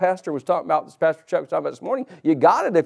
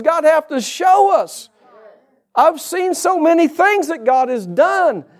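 higher, louder preaching build; rising pulpit preaching; 8 semitones higher, 3 dB louder than usual. Acted. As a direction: lift it and drive the point home. Preaching climax to congregation.

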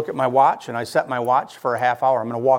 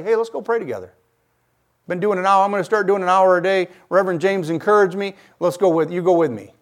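raised, louder voice urging a friend along; eager invitation; 5 semitones higher, 5 dB louder than usual. Acted. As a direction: act out an upbeat invitation to join in. Acting out an invitation to pray.

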